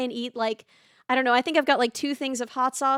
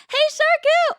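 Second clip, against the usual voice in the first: a bright burst of hello, pitched way up; excited greeting; 14 semitones higher, 6 dB louder than usual. Real, not acted. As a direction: call out high and loud. Calls out greeting to a viewer.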